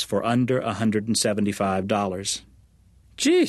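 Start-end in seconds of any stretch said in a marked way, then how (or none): 3.2-3.5 sudden high, loud exclamation; exasperated jeez. A narrator's reading. Rental lady to a picky client, high exasperated exclamation. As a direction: throw out a squeaky, put-upon jeez. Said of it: "said the rental lady".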